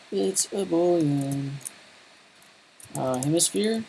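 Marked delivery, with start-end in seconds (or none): 0.1-1.5 slow drawn-out repeat. Pretend. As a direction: stretch out It's a Boolean slowly, a bit higher.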